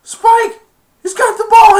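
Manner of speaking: very high, loud warning; alarmed yell. Retold or imitated. Player warning Spike who has the ball, very high and loud. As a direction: scream the warning. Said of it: "he's like".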